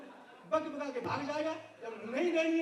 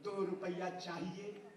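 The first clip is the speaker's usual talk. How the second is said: beggar asking; 8 semitones lower, 3 dB quieter, slower than usual. Retold or imitated. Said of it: "एक भिखारी आया और आते-आते बोला".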